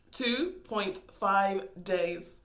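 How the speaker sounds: "Two point five days" spelled out very slowly, each word weighed; slow emphatic repeat. Shown as a demonstration. To the audience, stressing how short it was, slow. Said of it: "he knows me for two point five days".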